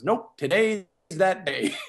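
slow, laughing retelling of the friend's line; friend's firm reply, retold with a laugh; laughing quote. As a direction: chuckle through it slowly. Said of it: "And he goes".

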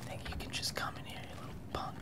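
barely audible whispered threat; menacing whisper. Pretend. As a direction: whisper it to an intruder. Menacing an intruder under breath.

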